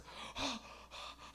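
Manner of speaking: high, voiceless mock gasping; breathless gasp. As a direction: heave for air as if choking. Dad choking on hot sauce.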